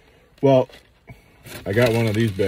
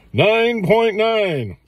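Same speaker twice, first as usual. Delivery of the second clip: high, slow drawn-out score announcement; excited; 12 semitones higher, much slower than usual. Shown as a demonstration. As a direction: stretch it out like a triumphant verdict. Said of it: "repeats the score".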